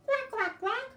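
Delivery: put-on tiny squeaky baby duck; baby duck quack. Shown as a demonstration. Teacher voices a little baby duck, very high quacks. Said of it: "You can be a little baby duck".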